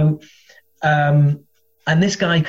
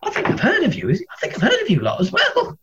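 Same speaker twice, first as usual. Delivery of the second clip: much higher, unsure recall; politely half-remember the band; hesitant woman; 13 semitones higher than usual. Retold or imitated. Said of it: "she was like".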